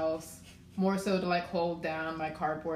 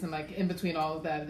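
normal talking voice

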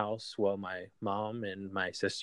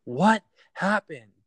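higher, louder, slow drawn-out cry; desperate shout; 9 semitones higher, 8 dB louder, much slower than usual. Retold or imitated. Son at the scene calling to his crying mom: slow desperate shout. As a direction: demand it again, stretched and pleading. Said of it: "And I'm like".

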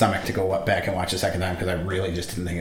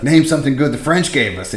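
higher and louder, a threatening demand; mock bullying shout; 6 semitones higher, 7 dB louder than usual. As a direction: pin him down and bark the question. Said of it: "he's like".

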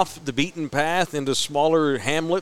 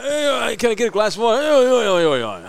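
mock thick English dialect, pitched up; garbled English bartender; 7 semitones higher than usual. Drunk bartender answering a thirsty tourist, garbled English accent. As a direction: imitate an unintelligible British barman. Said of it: "He couldn't understand me either".

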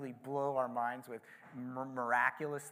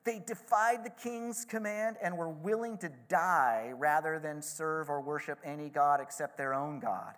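ordinary delivery

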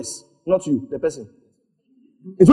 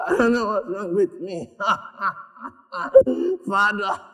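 real, helpless giggles through the words; can't get it out for laughing; 2 semitones higher than usual. Real, not acted. Cannot stop laughing at own prayer.

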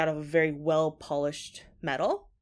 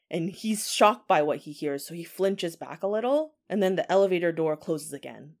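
plain reading voice

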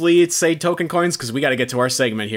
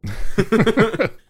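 laughing; 9 semitones higher than usual. Real, not acted. Laughing at the joke.

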